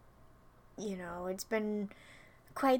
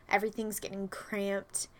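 ordinary delivery

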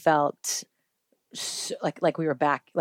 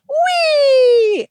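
very high, loud, drawn-out squeal; imitating gleeful child; 10 dB louder than usual. Mom mimicking her son on a bumpy plane, mock gleeful squeal. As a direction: whoop like a kid on a ride. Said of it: "he was like".

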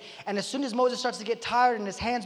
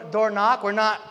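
usual speaking voice

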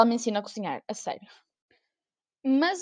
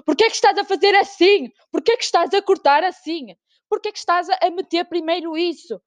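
higher, louder mock chef interrogation; mock Gordon Ramsay; 8 semitones higher, 4 dB louder than usual. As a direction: grill the contestant like a furious TV chef. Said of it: "ele é Gordon Ramsay". (mãe como Gordon Ramsay, a interrogar em tom agudo e zangado)

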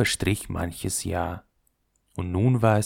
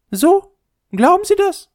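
very high, dainty ball voice; the leather ball snubbing the top, very high; haughty little ball; 9 dB louder than usual. Acted. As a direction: look down your nose at the top.

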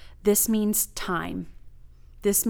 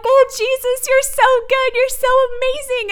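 high, loud whooping praise; joyful hollering; 7 dB louder than usual. Retold or imitated. Woman praising Jesus alone in her car, hooting and hollering. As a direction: holler it out overjoyed, pitch way up. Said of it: "I was hooting and hollering in my car".

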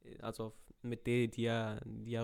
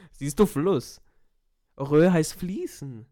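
louder, slow triumphant point; 4 semitones higher, 13 dB louder, much slower than usual. Real, not acted. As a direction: hammer the point home, loud and slow. Triumphant explaining, loud and slow. (triumphierend laut und langsam erklärt)

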